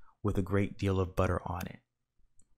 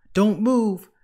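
much higher, loud shout; frantic shout; 12 semitones higher, 10 dB louder than usual. A narrator's reading. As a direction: yell at them to freeze. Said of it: "shouted Mister Watson".

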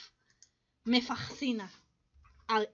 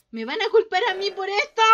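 raised, loud worried cry; panicked wail; 4 semitones higher, 6 dB louder, faster than usual. Pretend. Player fearing blame for the mistake, panicked wail. Fears being blamed in game.

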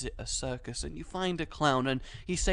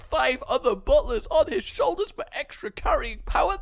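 much higher and much louder, building into a manic rant; a clown spiraling into an unhinged battle cry, higher and louder all the way; frantic clown voice; 12 semitones higher, 8 dB louder than usual. Retold or imitated. Clowns rant to the visitor about their plan, in a manic high yell. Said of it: "And they're like".

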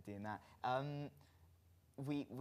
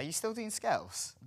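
high-pitched query; 9 semitones higher than usual. Real, not acted. Host teasing about put-on accent.

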